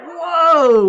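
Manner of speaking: loud, high-pitched whoa of mock alarm; startled whoa. Teacher retelling reaction to a heavy remark, loudly startled. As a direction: blurt a big, high whoa, half laughing. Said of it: "I was like".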